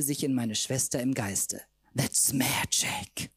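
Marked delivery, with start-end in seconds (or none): 2.0-3.3 slow hushed whisper; slow mysterious whisper. Acted. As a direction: breathe it like a spell. Drunk mystical whisper.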